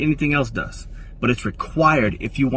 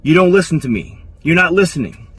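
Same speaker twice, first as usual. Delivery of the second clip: louder, higher mock frustration; a touch of exasperated pushback; frustrated complaint; 6 semitones higher, 6 dB louder than usual. Woman escalating after he gets defensive, louder. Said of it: "she's gonna say".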